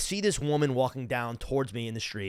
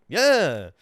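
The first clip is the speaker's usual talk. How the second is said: louder, higher, drawn-out yeah; eager agreement; 5 semitones higher, 8 dB louder than usual. Retold or imitated. Friends offered a smoke by the bartender, eager agreement. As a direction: jump at the offer, loud and high.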